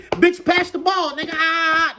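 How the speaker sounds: high, loud playful yell; hollered, high-pitched imitation of guys playing ball; rowdy ballplayer banter. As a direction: shout it like trash talk on the court. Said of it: "that's how they talk".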